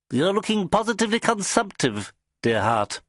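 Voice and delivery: high, fussy mock concern; teasing little boss. A narrator's reading. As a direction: fuss over him from up high. Boss teases narrator.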